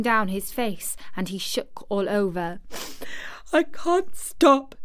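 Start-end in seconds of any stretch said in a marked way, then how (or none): 3.5-4.7 high, loud, gasping sobbed plea; gasping sobs. A narrator's reading. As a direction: sob it out between gasps. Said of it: "he gasped and sobbed".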